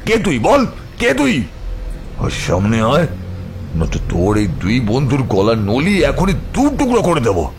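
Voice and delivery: menacing roared threat; tiger-like roar. Acted. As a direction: challenge the hidden enemy, surging upward on key words. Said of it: "বাঘের মতো গর্জন করে বলে উঠল".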